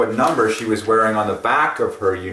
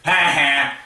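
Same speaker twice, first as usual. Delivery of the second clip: mock gloating; 7 semitones higher, 4 dB louder, slower than usual. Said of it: "She's not saying".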